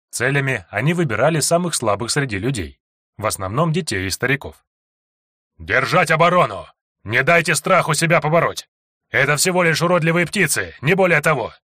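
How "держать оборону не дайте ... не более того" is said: loud, high battle cry; rally the fighters; rallying shout. A narrator's reading. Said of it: "подбадривал глава".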